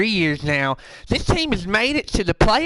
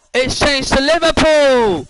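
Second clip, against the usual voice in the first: slow, louder mock Liverpool accent; 5 semitones higher, 5 dB louder than usual. Host notes his accent drifting, slow mock Liverpool accent. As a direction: drag the words out in a put-on Liverpool accent. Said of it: "my accent says, changed".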